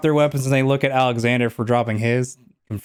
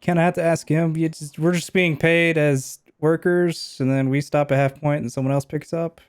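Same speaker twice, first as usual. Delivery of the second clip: captured henchman voice; 5 semitones higher than usual. Acted. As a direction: play the scared hired thug giving up the boss.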